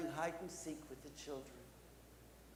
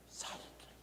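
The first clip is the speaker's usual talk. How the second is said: whispered storyteller suspense; secretive, very soft; hushed; 11 dB quieter than usual. A narrator's reading. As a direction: drop to a whisper. Narrator hushes for the hiding werewolf.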